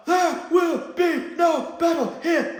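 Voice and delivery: [name]'s fake falsetto shout, high and loud; falsetto yell. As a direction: shout it slowly in a put-on falsetto. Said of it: "that falsetto voice".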